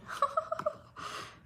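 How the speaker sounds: breathy high giggle, caught off guard; nervous disbelieving giggle; high nervous laugh. Real, not acted. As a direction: laugh despite the pain. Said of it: "I shouldn't be laughing".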